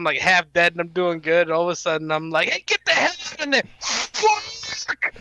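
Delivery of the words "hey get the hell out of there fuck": fast, very high mock panic; retold gamer panic. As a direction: squeal it as the boss fight falls apart. Retelling panic during boss fight.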